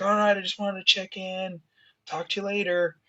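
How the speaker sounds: a hint of a chirpy father signing off; imitating dad on the phone.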